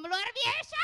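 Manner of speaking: sudden loud, drawn-out high shout; too-loud cheer. Pretend. Puppet praising the children at church, far too loud. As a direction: overdo the praise into a big held yell. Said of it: "Kekencengan ya, sorry ya".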